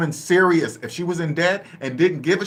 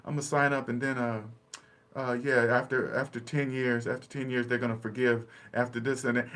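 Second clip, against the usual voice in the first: mumbling clueless borrower; 5 semitones lower, 9 dB quieter than usual. Retold or imitated. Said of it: "They don't even know what they're saying".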